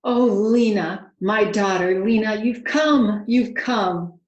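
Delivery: loud, slow overjoyed cry; king loudly welcoming his daughter home; joyful father. A narrator's reading. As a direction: pour out relief at finding her. King finds his lost daughter.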